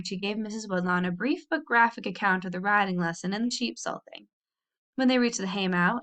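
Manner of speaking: ordinary delivery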